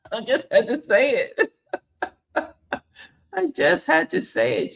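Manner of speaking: high, laughing through the words. Real, not acted. Teasing sparring partner Jazzy, laughing. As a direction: giggle while owning the tease.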